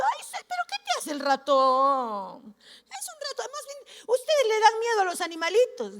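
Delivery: voz aguda y mimosa en burla; indulgencia burlona. Retold or imitated. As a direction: imita con sorna a quien consiente al ratoncito. Imita a quien tolera las ratas. (vocecita condescendiente y burlona)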